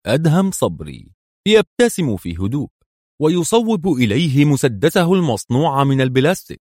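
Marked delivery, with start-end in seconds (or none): none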